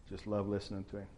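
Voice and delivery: ordinary talk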